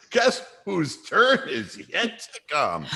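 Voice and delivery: slow, words broken by laughter; laughing. Real, not acted. Host warning who gets offended next, laughing tease. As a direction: tease through your own laughter.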